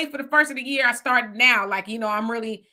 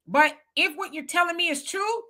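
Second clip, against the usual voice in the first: ordinary talk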